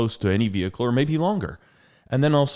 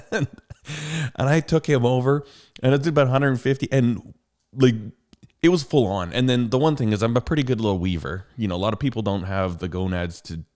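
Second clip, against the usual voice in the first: normal talking voice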